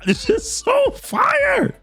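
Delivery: very high, slow, drawn-out squeal; excited squeal. Real, not acted. Recapper gushes over the Phoenix's entrance, squealing with excitement. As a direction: stretch "so fire" in giddy falsetto.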